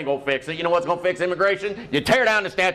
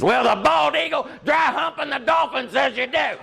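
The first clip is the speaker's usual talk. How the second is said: high mock yell, pointing at his tattoos; tough shout; 8 semitones higher than usual. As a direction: bark it high and proud, like a threat. Tattooed president answers summit.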